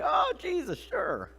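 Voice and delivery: high-pitched, breezy pretend consent; hammed-up cheerful yes; mock easy agreement. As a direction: act all too willing. Mimics an easy, willing agreement.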